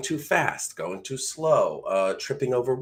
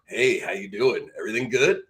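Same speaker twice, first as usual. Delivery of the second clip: lower voice tone. Shown as a demonstration. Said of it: "Different voice tones".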